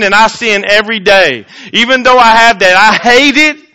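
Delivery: loud, high preaching voice; fervent sermon delivery. Acted. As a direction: preach it out to the congregation. Preaching at full force.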